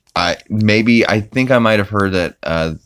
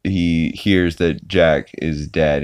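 ordinary talk

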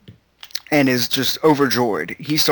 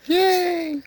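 squeaky cheer. Pretend. Co-host cheers in put-on voice.

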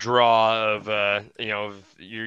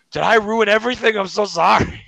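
much higher and louder mock-worried apology; a lightly voiced, higher, louder Pete; imitating Pete's apology; 8 semitones higher, 6 dB louder than usual. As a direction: ham up Pete fretting. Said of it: "he goes".

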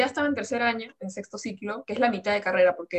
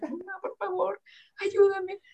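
high pleading; 11 semitones higher, slower than usual. Retold or imitated. Pleading with mother.

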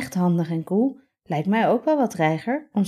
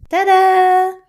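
high, loud triumphant reveal; cheerful tada; 10 dB louder than usual. Acted. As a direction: present it with a flourish. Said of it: "roept Robin".

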